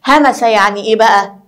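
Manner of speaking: usual speaking voice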